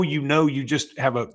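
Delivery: ordinary talk, nothing marked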